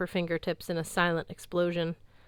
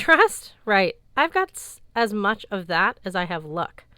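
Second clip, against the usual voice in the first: scoffing; 2 semitones higher, 8 dB louder than usual. Acted. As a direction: laugh the word off in scorn. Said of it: "Mallory laughed".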